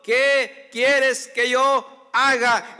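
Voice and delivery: drawn-out, slow, loud plea; slow pleading. Acted. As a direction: stretch each word as a cry to God. Prayer quote stretched in sermon. (súplica lenta y fuerte)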